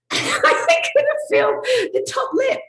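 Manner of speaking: high, loud squeal through laughter; slow, squeaky, hysterical wheeze; helpless laughter. Real, not acted. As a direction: barely get it out for laughing. Cracks up recalling numb kiss.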